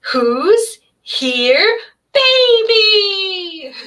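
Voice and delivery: put-on high happy baby-talk cheer; excited motherese. Shown as a demonstration. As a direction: squeal it up high to delight a baby. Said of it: "my voice goes higher with babies, and that's called motherese".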